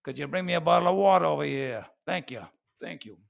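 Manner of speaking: quick New Yorker with dropped Rs; lightly tossed-off New York request and thanks; New York accent. Acted. New Yorker asking for water over here, New York accent. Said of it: "the New Yorkers, for example".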